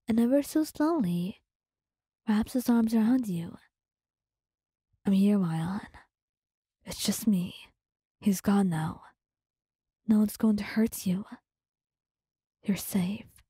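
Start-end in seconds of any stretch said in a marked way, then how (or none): 5.1-13.4 gentle comfort. Acted. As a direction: soothe her after the scare, barely above a breath. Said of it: "he says gently".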